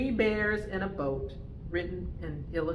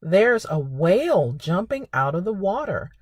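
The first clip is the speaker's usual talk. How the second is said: put-on reading to children; 7 dB louder than usual. Reads picture book to children.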